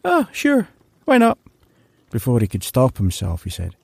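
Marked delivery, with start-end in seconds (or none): 0.1-1.4 high, giddy give-in; giddy yes. Acted. Larry agreeing to the fortune teller, giddy and high.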